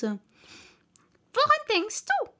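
high, slow, stern mouse question. A narrator's reading. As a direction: demand sharply like a strict little mouse. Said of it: "sagte die Maus streng".

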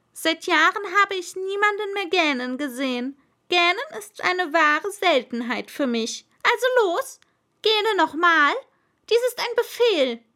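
very high, loud royal voice rising to a shout; pompous king building to a loud command. Acted. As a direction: order it grandly, building to a demand. King orders yawning.

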